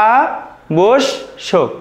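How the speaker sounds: আবশ্যক drawn out very slowly as "a-bosh-shok" with a doubled sh; slow model word. Shown as a demonstration. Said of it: "দেখো, আবশ্যক".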